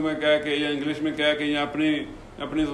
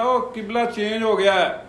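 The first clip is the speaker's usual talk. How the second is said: higher-pitched retold call to a group; 6 semitones higher than usual. Man telling worshippers mid-prayer the qibla changed, calling out. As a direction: call out as if alerting people, raised pitch.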